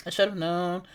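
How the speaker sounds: normal talking voice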